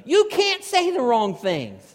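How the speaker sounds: loud, high, slowed declaration; emphatic punchline. Real, not acted. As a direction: drive the point home loudly. Punchline repeat of his main point.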